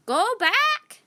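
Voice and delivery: put-on a parrot warns the children; squawked. A narrator's reading. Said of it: "said Polly".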